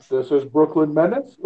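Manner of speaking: a lightly voiced announcer intro; imitating Brooklyn Menace. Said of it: "but I am clip".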